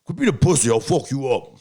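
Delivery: put-on bully the kid like an angry father; threatening dad. Imitating his dad threatening him, mock angry. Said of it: "my dad's more like".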